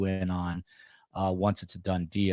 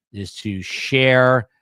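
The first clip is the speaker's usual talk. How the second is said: drawn-out emphasis; 4 semitones higher, 8 dB louder, much slower than usual. Real, not acted. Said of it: "I'm out of breath now".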